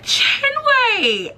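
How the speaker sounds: very high, drawn-out name call; mock incredulous call. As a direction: imitate coworkers hollering in disbelief. Retelling coworkers calling out her name.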